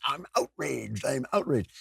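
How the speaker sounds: put-on indignant wail, not shouted; mock outrage. Host imitating people on Veterans Day, mock outrage. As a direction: imitate the perpetually offended, lightly hammed up. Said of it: "hear people say".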